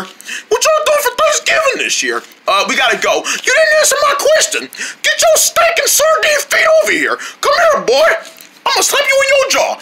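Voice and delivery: squeaky little fighter picking a fight; feisty fish. Acted. Fish threatens the sardine with a high laughing voice.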